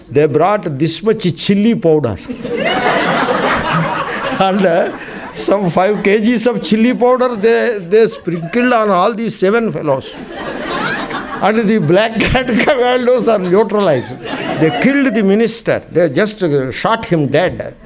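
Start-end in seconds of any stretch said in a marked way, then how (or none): 2.2-4.3 high breathless giggle; wheezing laugh. Real, not acted. Swami laughing at his own story, breathless giggle. 4.4-15.8 laughing while telling. Real, not acted. Laughing through the chili story.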